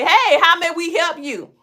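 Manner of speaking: nosy neighbor. Retold or imitated. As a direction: play the suspicious neighbor quizzing a stranger. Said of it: "point and say".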